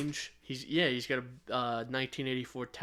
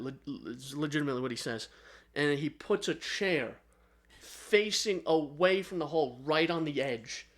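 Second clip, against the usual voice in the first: ordinary talk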